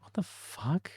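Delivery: a stunned whisper, high and breathy; shocked under-the-breath whisper; whispered disbelief. Retold or imitated. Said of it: "I was like".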